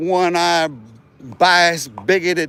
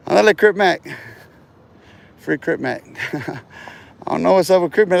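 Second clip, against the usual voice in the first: ordinary delivery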